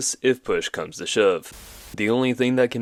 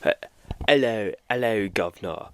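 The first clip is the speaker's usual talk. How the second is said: put-on a weak stab at a cockney British accent; British accent, badly done. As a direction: fumble through a British accent greeting. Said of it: "get an accent for this one".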